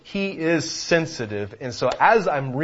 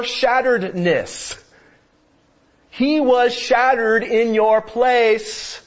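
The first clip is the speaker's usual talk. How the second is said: preaching at full force; 7 semitones higher, 5 dB louder than usual. Acted. Preaching climax.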